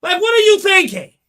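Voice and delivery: high, loud exasperated outburst; anger. Pretend. Anger in the mother's head scolding, loud outburst. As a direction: blow up at them in disbelief. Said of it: "That's anger".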